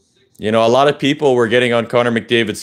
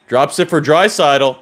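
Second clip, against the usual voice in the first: ordinary talk, nothing marked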